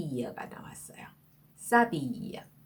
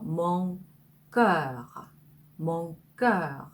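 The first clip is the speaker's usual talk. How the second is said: normal talking voice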